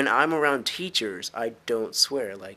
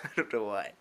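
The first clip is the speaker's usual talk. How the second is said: ordinary talk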